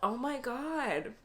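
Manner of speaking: slow, laughing through tears; real teary laugh. Real, not acted. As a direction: giggle at yourself for crying.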